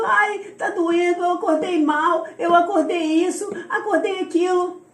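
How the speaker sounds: high mock whine; imitated whimpering. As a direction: mimic a complainer listing every ache. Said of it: "lá choramingando".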